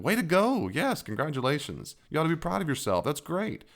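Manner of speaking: high, bright-up proud-parent praise; cheering praise. Retold or imitated. Dad cheering his children's good work, high and gushing. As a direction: lift the pitch and gush to your kid. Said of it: "I absolutely stroke the snot".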